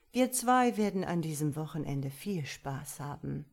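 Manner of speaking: plain reading voice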